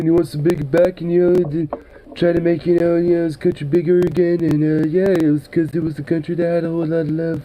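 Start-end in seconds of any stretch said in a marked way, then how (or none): 3.2-7.4 quick mock quote of Trump. Streamer parroting Trump to chat, a quick mock quote. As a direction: toss off his line with scorn. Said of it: "It's like the Soviet Union".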